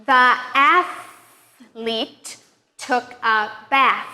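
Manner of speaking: very slow, long voiceless TH in athlete and bath; exaggerated TH. Shown as a demonstration. Teacher modeling a practice sentence, very slowly exaggerating TH in athlete and bath. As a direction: dwell on the TH of athlete and bath, word by word. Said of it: "practice some sentences with the T H sound".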